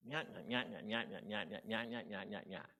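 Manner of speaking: soft, repetitive nyah-nyah yammering; nagging critic chatter. Acted. Inner critic taking charge, nagging. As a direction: drone on like an inner nag. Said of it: "my inner critic shows up and was loud".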